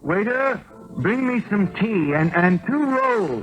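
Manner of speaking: calling the waiter. Acted. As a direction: raise your voice so the waiter hears you over the tavern. Calling across tavern to waiter.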